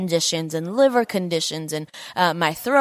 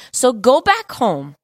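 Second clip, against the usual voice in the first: slow, raised proclamation of "go back home"; emphatic command; 4 semitones higher, 5 dB louder, slower than usual. Acted. Jesus sending the Roman officer home, emphatic slow command. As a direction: GO BACK HOME loud, high and drawn out.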